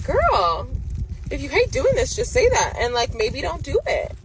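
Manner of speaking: loud, high-pitched scolding; exasperated. Acted. Customer telling off an unwelcoming braider, exasperated. Scolding the hairstylist in her retelling.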